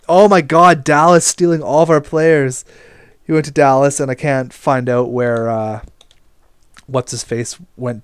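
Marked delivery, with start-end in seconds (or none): 0.1-2.6 loud, higher exasperated cry; frustrated outburst. Real, not acted. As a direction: blow up at Dallas stealing players. Losing players, frustrated rant.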